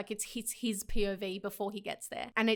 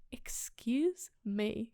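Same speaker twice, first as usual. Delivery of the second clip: very slow, drawn-out reaction; slow, incredulous pause on every word; mock disbelief; 4 dB quieter, much slower than usual. Acted reaction to romantic line.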